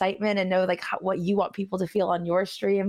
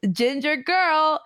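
slow quoted greeting; 7 semitones higher, much slower than usual. Retold or imitated. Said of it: "and he goes".

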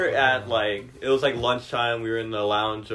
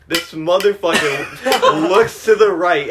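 laughing; 4 semitones higher, 5 dB louder, much slower than usual. Real, not acted. Laughs telling the story.

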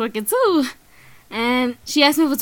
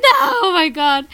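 higher, dread-filled groan at the news; spooked; 6 semitones higher, slower than usual. Retold or imitated. Retelling seeing the creepy woman board the bus, mock-spooked. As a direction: recoil as if she's coming for you.

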